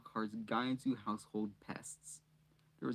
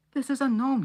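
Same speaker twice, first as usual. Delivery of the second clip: loud, much higher cry; grim announcement; 14 semitones higher, 11 dB louder than usual. A narrator's reading. Ron presenting the gnome to Harry, loud and high. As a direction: shout it out, holding up the catch. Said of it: "he said grimly".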